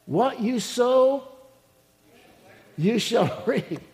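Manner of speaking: lightly voiced scolding garden, high start; mock reproof, slowing to a drawn-out finish; talking garden retort. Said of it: "my garden's gonna say".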